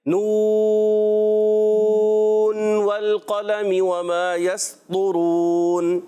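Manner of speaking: slow recited chant of نون والقلم; drawn-out recitation. Shown as a demonstration. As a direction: stretch نون long at the start. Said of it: "والقلم میں جو ہے".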